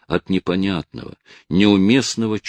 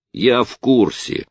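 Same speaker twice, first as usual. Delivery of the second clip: brushing it off; 8 semitones higher, 5 dB louder, slower than usual. A narrator's reading. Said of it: "отмахнулся парень".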